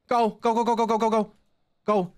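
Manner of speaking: high, rapid-fire chant of urgency; frantic urging. Real, not acted. Player fleeing the tall ghost, high frantic urging. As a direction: urge yourself on in a high voice.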